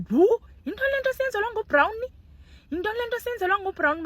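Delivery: high, loud incredulous cry; can't believe what Brown is doing to us; disbelief. Real, not acted. Vlogger reacting in disbelief.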